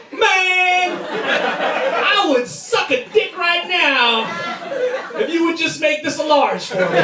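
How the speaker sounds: high, drawn-out emphatic begging; over-the-top customer plea. Acted. Customer begging the counter guy for a larger meal, overblown plea. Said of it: "telling the guy behind the counter".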